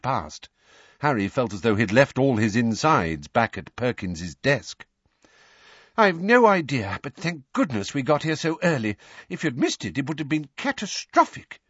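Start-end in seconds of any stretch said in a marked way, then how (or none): 6.0-11.6 high-pitched, harried answer; agitated. Acted. Weasley rushing Harry along the corridor, agitated. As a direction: answer with worried urgency. Hurrying, relieved yet alarmed reply.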